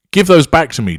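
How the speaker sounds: loud, high demand. Acted. Victim demanding her pills back, loud and high. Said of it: "demands the victim".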